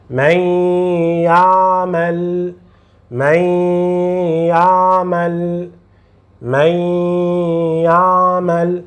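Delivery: من يعمل with a long, level nasal y on the join; nasal ghunna. Shown as a demonstration. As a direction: merge n into y and hold the hum in من يعمل. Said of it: "faça o som nasal".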